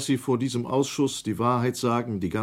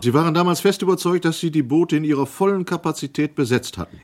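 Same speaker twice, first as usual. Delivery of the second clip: fast, louder, higher challenging question; pressing challenge; 5 semitones higher, 5 dB louder, faster than usual. Acted. Inquiry member pressing the officer, fast and sharp. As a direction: push him hard on the boat loading.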